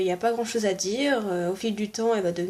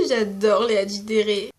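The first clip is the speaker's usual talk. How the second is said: usual speaking voice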